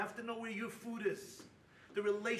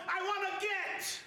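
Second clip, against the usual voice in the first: mock demanding husband; 6 semitones higher, slower than usual. Couple demanding a divorce.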